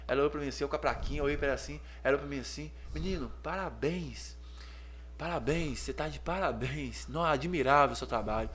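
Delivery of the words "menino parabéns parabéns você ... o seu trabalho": tender praise. Retold or imitated. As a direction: praise him gently, touched. Moved woman praising him.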